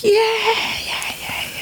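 high, drawn-out cheering yeah, fading away; excited cheer. Real, not acted. Host celebrating her favourite soap's return, excited cheer.

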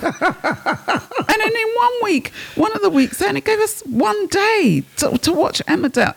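high-pitched, laughing indignation; comic outrage. Real, not acted. Soap fan protesting the football schedule, comic outrage.

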